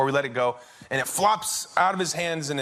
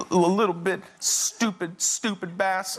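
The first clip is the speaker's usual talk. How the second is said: higher, mock-sobbing grumble; crying child, imitated; 4 semitones higher than usual. Son still cursing the fish, imitated crying. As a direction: sob through it like a sulky small boy. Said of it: "continues to cry".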